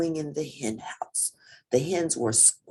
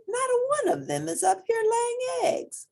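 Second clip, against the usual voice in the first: much higher, lightly voiced farm wife; Missus Tucker. A narrator's reading. Farm wife grumbling to her husband about the hens, high.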